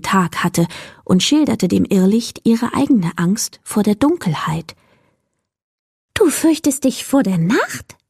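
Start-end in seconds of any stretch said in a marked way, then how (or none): 6.1-8.0 high creature voice, in disbelief; the will-o'-the-wisp can't believe it; incredulous. Acted. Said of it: "fragte das Irrlicht ungläubig". (hohe Irrlicht-Stimme, ungläubig)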